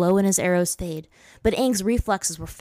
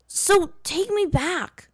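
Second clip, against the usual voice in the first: much higher, slow, stretched command; ordering; 12 semitones higher, 3 dB louder, much slower than usual. A narrator's reading. Aang ordering Roku to send him home, high and slow. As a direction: demand it, slowly and high. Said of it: "Aang ordered".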